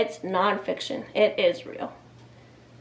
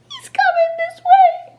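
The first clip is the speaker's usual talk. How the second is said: put-on frightened child; 6 dB louder than usual. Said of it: "whispered Eddie".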